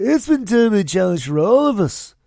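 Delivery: [name]-like. Retold or imitated. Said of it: "I sound like a [name]".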